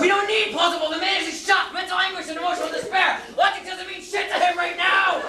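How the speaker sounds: high-pitched frantic rant; worked-up, indignant outburst; exasperated shouting. Acted. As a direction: shout down every objection, building to the last word. Defending story in heated argument.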